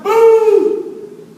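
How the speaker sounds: loud, high, stretched-out holler; imitated yell. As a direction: yell a pet name across the hall. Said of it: "And then she yells out".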